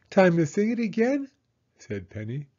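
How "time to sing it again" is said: put-on little girl voice. Child Penny voiced high.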